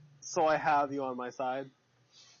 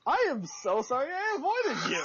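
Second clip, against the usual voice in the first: high-pitched, hammed-up sorry; over-the-top apology; 11 semitones higher than usual. Player apologizes to the knight they avoided, high and hammed-up. Mock apology in character, listener laughs.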